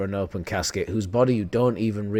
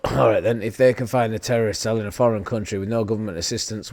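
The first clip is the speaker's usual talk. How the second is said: ordinary delivery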